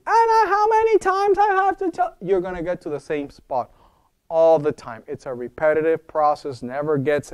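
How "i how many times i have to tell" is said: scolding mom. Retold or imitated. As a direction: scold the kid for the hundredth time. Mommy scolding Jimmy again.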